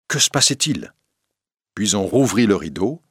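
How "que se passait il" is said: mildly agitated, higher-pitched question; a touch of an impatient audience. A narrator's reading. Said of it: "Le public s'énerva". (légèrement énervé, voix plus haute)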